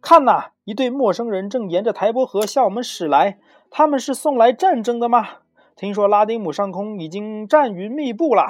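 high, loud lookout's cry; shouted alarm. A narrator's reading. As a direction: call out a warning to everyone. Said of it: "突然有人大喊道".

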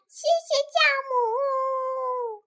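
high voice, last word stretched out; slow, sing-out thanks up high; grateful Lily. Acted. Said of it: "莉莉说".

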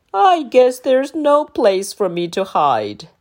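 lightly voiced sad giant; a hint of disappointment; glum dinosaur. A narrator's reading. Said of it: "said the dinosaur".

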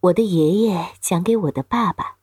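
ordinary delivery

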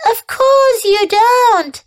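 slow, high, soothing reassurance; comforting. A narrator's reading. Spider comforting the frightened pig, slow and soothing. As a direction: comfort a frightened friend gently. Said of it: "said Charlotte in a comforting voice".

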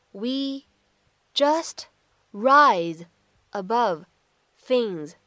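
slow, one word at a time, pausing between. Shown as a demonstration. Teacher breaking the line into single words for students. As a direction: spell the sentence out word by word. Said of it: "注意的发音技巧".

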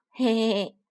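loud hey-hey-hey laugh; acted chuckle. Tyrant dinosaur teasing the little one over fruit, chuckling. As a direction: chuckle at your own teasing.

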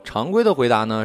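plain reading voice

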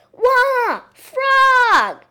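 high, loud startled shriek. Acted. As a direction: scream at the frog. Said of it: "she shrieked".

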